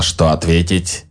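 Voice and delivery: plain reading voice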